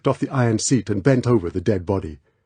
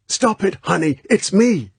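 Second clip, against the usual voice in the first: shout; 9 semitones higher than usual. Acted. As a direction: yell to get through to her. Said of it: "Bond's shout got through to her".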